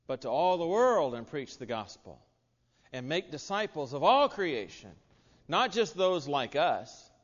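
slow, emphatic ending on not just those like us; forceful preaching. Real, not acted. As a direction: drive home the command to the whole world.

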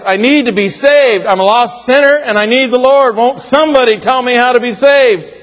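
high, pleading cry for help, near tears; a weeping man begging aloud to be saved; crying out loud. A narrator's reading. Weeping stranger on a bus cries out to be saved, high and pleading. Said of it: "he cried out in a loud voice".

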